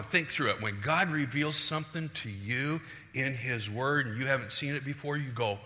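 ordinary talk

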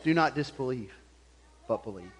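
soft, low, gentle plea; gracious, merciful. Retold or imitated. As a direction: urge him softly, with mercy. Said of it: "in a gracious, merciful way".

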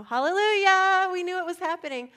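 hammed-up triumphant celebration; jubilant praise. Imagined followers cheering at the tomb, mock-jubilant.

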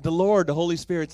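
loud preaching burst; preacher's booming opener. Real, not acted. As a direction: open big and loud.

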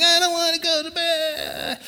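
high mock-crying little boy; imitating a boy crying at bedtime; crying child. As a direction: wail like a tired child. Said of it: "he's crying".

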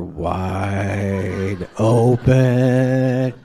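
slow, drawn-out words of mock horror; dawning dread. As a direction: stretch each word as the blunder sinks in. Drawn-out horrified realisation in story.

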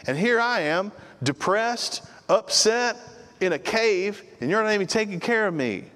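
high, pleading, self-pitying voice; whiny complaining Elijah. Retold or imitated. Elijah complaining to God in the cave, high and whiny. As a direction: whine it out like a sulking prophet. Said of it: "He says".

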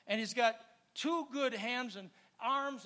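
ordinary talk

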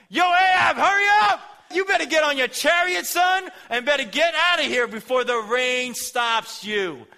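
high, loud shouting call; retold shout. Preacher shouting Elijah's warning to Ahab, loud and high. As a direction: yell at him to hurry, high and loud. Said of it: "He shouts".

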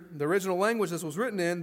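usual speaking voice

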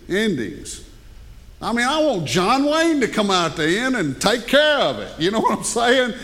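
ordinary talk

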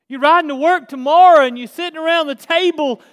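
loud, rising preacher's build; preaching at full force. Acted. Pastor preaching to his church, full force. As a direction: drive it home to the church.

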